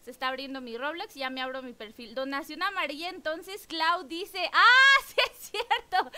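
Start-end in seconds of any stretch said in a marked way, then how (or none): 4.5-6.1 laughing realization. Real, not acted. Remembers promise on yellow donation.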